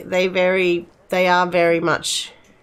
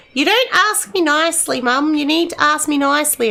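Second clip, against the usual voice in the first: daughter's voice pitched higher, a touch indignant; mimicking daughter; 7 semitones higher than usual. Mother imitating her teenage daughter scolding her, pitched higher. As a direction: play the teen scolding her mum. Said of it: "she'd be like".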